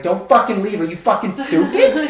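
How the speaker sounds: hammed-up scolding through a laugh; mock aggressive scolding. Retelling to a friend, a laughing mock scold. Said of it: "so I'm aggressive".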